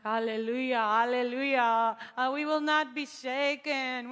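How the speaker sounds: loud, slow declaration of faith; loud praise. Retold or imitated. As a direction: proclaim it boldly in the rain. Walking in rain, declaring faith.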